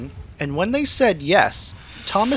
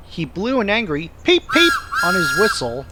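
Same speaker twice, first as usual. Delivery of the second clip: usual speaking voice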